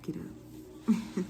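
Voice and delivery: breathy whisper of a spirit. Retold or imitated. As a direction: whisper it like an inner voice. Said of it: "the spirit was like".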